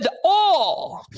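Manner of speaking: high, long country "awl" for "oil"; exaggerated high country pronunciation of "oil"; country accent. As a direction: mimic the country man drawling "oil" as "awl". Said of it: "That's how they say oil".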